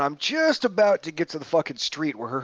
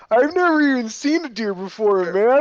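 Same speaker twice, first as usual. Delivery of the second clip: mocking whiny airman; 9 semitones higher, 3 dB louder than usual. Retelling the airman who just hit a deer, high whiny mocking voice. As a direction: parody a distraught young Californian kid. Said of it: "That is exactly how he sound".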